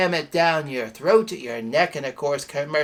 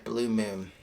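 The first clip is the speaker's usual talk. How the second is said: ordinary talk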